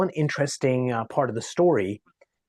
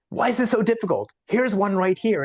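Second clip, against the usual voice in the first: higher, quicker exasperated voice; exasperated boss; 8 semitones higher than usual. Acted. Restaurant boss complaining on the phone about hiring, acted exasperation. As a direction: put on a frustrated boss, pitched up.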